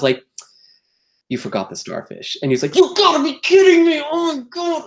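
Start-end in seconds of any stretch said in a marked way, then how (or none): 1.3-2.4 hushed reply. Retold or imitated. Telling the stage manager his mistake. 2.8-4.8 high, loud, hammed-up disbelief; mock shock. Stage manager realizing he forgot the starfish, high mock disbelief.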